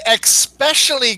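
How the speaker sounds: especially as ex-SPESH-ully; wrong especially. Shown as a demonstration. Host joking with the mistake, ex-SPESH-ully for especially. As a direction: put an X in especially. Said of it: "not ex specially".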